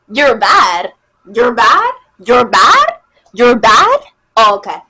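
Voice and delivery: loud, incredulous repeated question; mocking challenge. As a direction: throw his words back at him, loudly. Mocking his "my bad.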